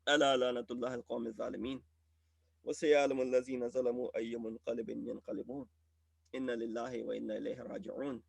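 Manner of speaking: soft, fast, low Arabic recitation; closing recitation. Acted. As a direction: recite the verses quickly under the breath. Recites closing Quranic verses.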